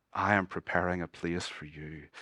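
soft, low words of assurance; hushed promise. Acted. Jesus promises his followers a place, hushed and low. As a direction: drop down low and quiet to reassure.

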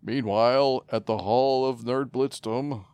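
loud, slow cartoon narrator; dramatic announcer. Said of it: "repeats bit as announcer".

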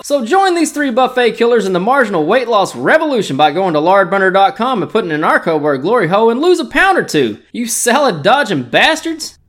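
cheesy commercial sign-off; ad read. Acted. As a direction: wrap up like a hype ad. Ad read closing pitch.

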